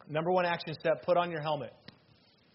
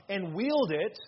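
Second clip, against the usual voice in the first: usual speaking voice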